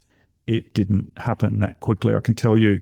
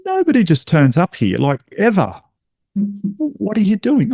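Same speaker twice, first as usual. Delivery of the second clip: incredulous; 9 semitones higher than usual. Retold or imitated. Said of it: "And we're like".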